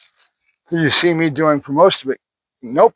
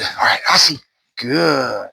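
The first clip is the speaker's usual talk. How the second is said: ordinary talk, nothing marked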